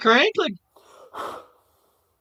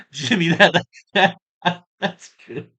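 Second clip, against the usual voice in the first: talking through real laughter; laughing. Real, not acted. Streamer cracking up at a viewer's joke, laughing. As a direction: break up laughing between the words.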